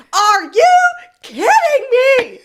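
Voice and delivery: high, loud mock scream; angry customer screaming across the drive-thru. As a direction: shriek it like a furious customer. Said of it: "Screams at this".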